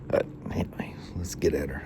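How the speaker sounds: soft whispered aside. Real, not acted. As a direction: whisper it under your breath. Hushed aside before starting work.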